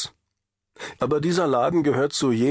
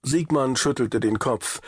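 ordinary delivery, nothing marked

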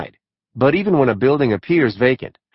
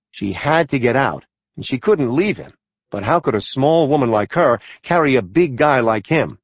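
ordinary delivery

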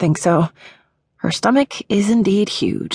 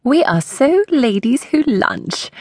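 a clumsy fake British accent, pitched up and slow; bad British accent; 9 semitones higher, slower than usual. Friend at lunch in a hammed-up fake British accent. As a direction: put on a terrible British accent. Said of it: "the worst attempt at a British accent".

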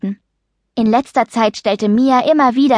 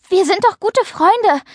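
high, earnest appeal to friendship; earnest, indignant high-pitched plea; indignant protest; 11 semitones higher than usual. A narrator's reading. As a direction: insist on the friendship, hurt and pitched up. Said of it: "Paula war ehrlich empört".

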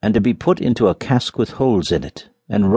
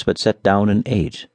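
ordinary delivery, nothing marked

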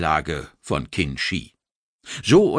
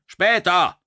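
curt dismissal; 11 semitones higher, 4 dB louder than usual. Acted. Commander cuts officer off.

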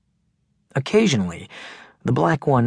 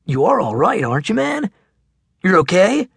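higher, a touch worried; concerned big kid; 7 semitones higher, 3 dB louder than usual. Acted. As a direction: check on your friend, a bit anxious. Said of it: "He looked concerned for a second".

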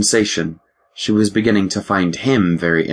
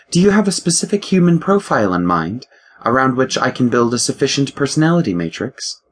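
higher, crisp voice of a ship's computer; lightly voiced ship AI, precise and higher; 6 semitones higher than usual. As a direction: play the ship's mind, a touch superior. The ship AI speaks.

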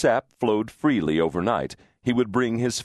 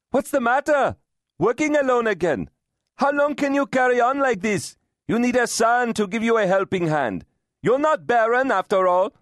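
high-pitched taunting call; jeering jibes; 4 dB louder than usual. A narrator's reading. Brother-in-law mocking Diti for having no son, jeering call. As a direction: needle her loudly, voice pitched well up. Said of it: "ignoring his jibes".

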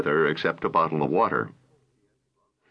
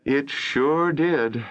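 very slow, high-pitched, strained politeness; forced weak smile; 7 semitones higher, much slower than usual. A narrator's reading. Holly forcing a weak smile for Joe. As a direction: push a smile through the words. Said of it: "forcing a weak smile".

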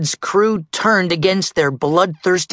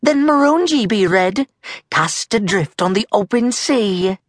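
higher, declaiming pirate voice; Molly passing sentence; 8 semitones higher than usual. Acted. Molly banishing Captain Red, higher declaiming voice. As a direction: pronounce the sentence grandly.

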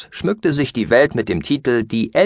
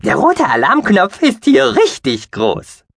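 very high, slow piping creature voice; squeaky mouse-beaver voice; 11 semitones higher, 4 dB louder than usual. Acted. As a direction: pipe it slowly like a small furry alien. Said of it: "piepste er".

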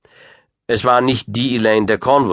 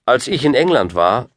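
put-on raised, boyish voice; a hint of a boy speaking up; young Lancelot; 5 semitones higher, 4 dB louder than usual. A narrator's reading. Boy beginning to ask about England, raised voice.

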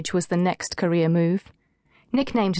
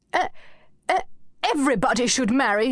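fast, sputtering higher protest; spluttering; 5 semitones higher, faster than usual. A narrator's reading. Anushka flustered by Kate's jab, spluttering fast. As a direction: splutter it out in a rush. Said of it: "Anushka spluttered".

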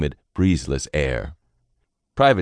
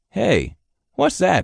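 much higher, slow and startled; surprised private; 3 dB louder, slower than usual. Acted. Private spotting a shape in the sky, startled. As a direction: catch sight of something odd overhead.

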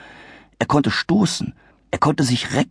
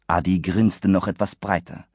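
ordinary delivery

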